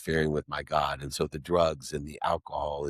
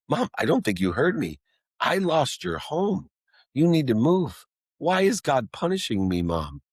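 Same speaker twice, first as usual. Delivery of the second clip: tearful pleading; 9 semitones higher than usual. Retold or imitated. Begging his mom why God punishes him, high tearful plea. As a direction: beg like a crying child. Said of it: "I'm like".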